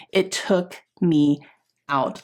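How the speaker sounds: drawn out, pausing before "out"; slow emphatic repeat. Real, not acted. Telling viewers how badly the injury hit, slow and emphatic.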